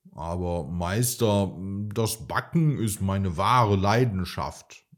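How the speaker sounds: usual speaking voice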